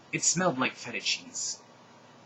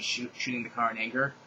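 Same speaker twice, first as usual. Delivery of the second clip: usual speaking voice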